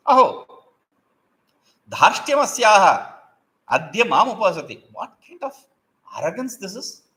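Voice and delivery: high, loud indignant outburst; the monkey, stung by unasked advice, with a high, loud indignant cry; outraged monkey. A narrator's reading. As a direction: burst out at the bird's cheek.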